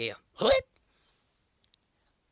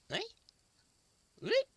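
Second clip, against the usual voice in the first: ordinary delivery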